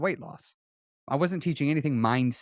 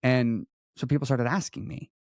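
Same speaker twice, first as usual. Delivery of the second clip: ordinary delivery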